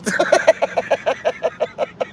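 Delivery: breathy high-pitched cackle; squeaky giggle fit; high wheezy laugh. Real, not acted. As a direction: let out a high airless laugh. Laughing at own joke.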